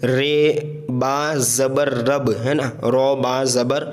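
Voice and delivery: drawn-out letter-by-letter spelling; slow teacher's spelling drill. Shown as a demonstration. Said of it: "हिज्जे करके पढ़े".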